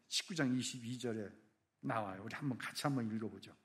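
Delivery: ordinary talk, nothing marked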